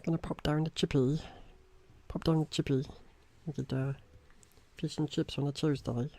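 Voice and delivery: lilting imitation of Newcastle speech; Newcastle accent. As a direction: put on a Newcastle local's voice. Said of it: "People from Newcastle will be like".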